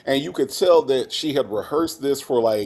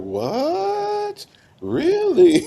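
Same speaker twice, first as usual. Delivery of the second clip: shocked mom; much slower than usual. Retold or imitated. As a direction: squeal the disbelief like a stunned mother. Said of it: "And the mom was like".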